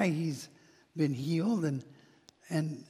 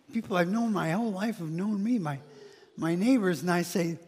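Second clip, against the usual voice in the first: usual speaking voice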